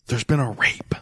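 secretive whisper of the bad news; whispered warning. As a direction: whisper it close so nobody in the hallway hears. Warning friend, cops nearby.

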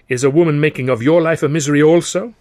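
high, loud French character question; animated Gallic commiseration; inquiring Frenchman. A narrator's reading. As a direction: ask as a theatrical, sympathising Frenchman. Narrator voices Maurice questioning his friend.

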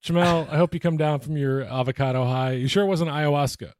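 normal talking voice

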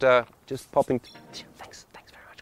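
hushed, apologetic whisper; timidly polite. Acted. Late traveller slipping past a family, meekly whispered politeness. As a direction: fade away into barely audible thanks. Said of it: "there's politer ways I could do that".

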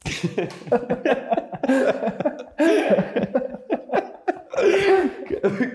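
long, high-pitched giggling fit; real high wheezing laugh; helpless laughter. Real, not acted. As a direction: crack up and can't stop laughing. Cracked up by the cream talk.

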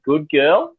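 high, rising praise; praising a dog. Retold or imitated. Comedian to his dog after it soils the carpet, high-pitched praise.

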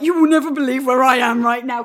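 loud excited blurt; retold thrill, said high and loud; excited text. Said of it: "And I was like".

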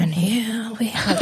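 hushed, slow wildlife narrator; nature documentary. Pretend. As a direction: narrate like Attenborough, softly. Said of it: "That's the impressions too".

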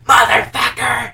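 loud, high mock curse shouted out; retold yell. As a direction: bellow the curse as she stabs him. She stabs him, yells.

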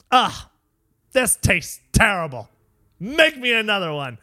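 mock-disgusted customer complaint, pushed up in pitch. Onlooker overhears a customer sending back a mocha, loudly disgusted. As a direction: complain like you've just tasted something foul.

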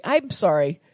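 ordinary talk, nothing marked

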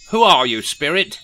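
loud, high demand to the ghost. A narrator's reading. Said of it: "demanded Rishi Scrooge".

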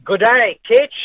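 overdone Australian accent, playful; Aussie twang. Shown as a demonstration. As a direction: put on a loud mock Australian accent to greet the host. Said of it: "should I use my Aussie twang for you".

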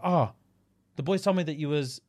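ordinary talk

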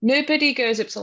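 usual speaking voice

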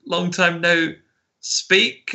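chirpy coworker swapping the word; mimicking catching herself. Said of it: "and she goes".

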